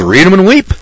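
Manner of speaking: smug card-table boast; dealer's gloat. Retold or imitated. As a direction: crow it like a winning dealer. Dealer taunts card players.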